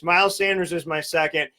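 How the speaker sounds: ordinary talk, nothing marked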